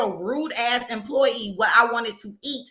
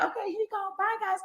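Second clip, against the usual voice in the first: higher, much softer dismissive cashier voice; mock breezy employee; 7 semitones higher, 9 dB quieter than usual. Customer imitates the employee brushing them off, breezy and soft. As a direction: toss off the goodbye like you don't care.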